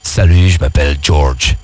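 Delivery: deep, slow American seducer voice; smooth-talking Hollywood charmer; leading man. Shown as a demonstration. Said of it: "dis, dis". (voix grave et lente de séducteur américain)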